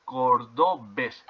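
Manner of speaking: cordobés slowly split into syllables, ending on a stressed BÉS; slow teacher's demo of cor-do-BÉS; stress on last syllable. Shown as a demonstration. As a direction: spell out the beats cor-do-bés, accent on bés. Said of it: "tiene el acento en la última sílaba".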